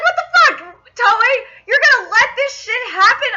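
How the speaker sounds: high-pitched indignant outburst; pissed off. Retold or imitated. Fan berating the animation studio over cut lines, pissed off. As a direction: take the studio to task, outraged. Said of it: "it pissed me off".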